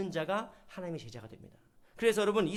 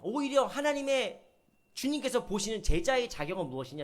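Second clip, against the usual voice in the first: ordinary talk, nothing marked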